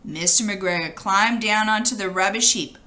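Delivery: plain reading voice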